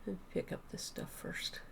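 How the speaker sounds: whispered aside to herself. Real, not acted. Quiet aside while playing.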